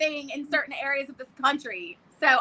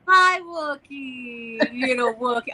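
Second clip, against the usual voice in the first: talking to a pet; 4 semitones lower, slower than usual. Real, not acted. Greeting the dog.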